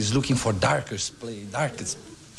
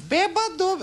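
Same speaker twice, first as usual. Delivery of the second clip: very high falsetto snake; cartoonishly high mocking call; taunting snake. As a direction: squeak it out as a teasing little snake. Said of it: "The snake goes".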